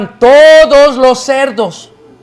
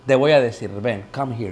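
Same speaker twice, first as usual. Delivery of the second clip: lower, softer, like sharing a secret; hushed confiding; 9 semitones lower, 9 dB quieter than usual. Retold or imitated. Tour guide quietly calling the pastor closer, hushed. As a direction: lean in and draw him aside quietly.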